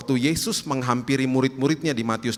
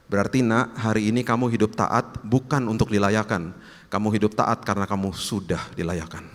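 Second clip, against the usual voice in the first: normal talking voice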